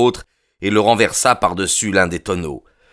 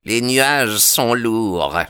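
slow, higher, altered voice; slow, pitched-up ritual answer; strained ritual reply; 4 semitones higher, much slower than usual. A narrator's reading. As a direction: intone the password reluctantly, drawn out. Solemn ritual reply after quarrel.

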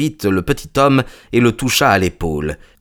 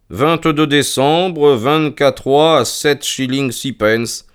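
slow, higher read-aloud; reading out an entry; 6 semitones higher, slower than usual. Acted. Reads the ledger aloud on demand.

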